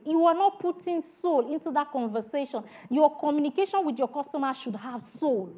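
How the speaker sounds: normal talking voice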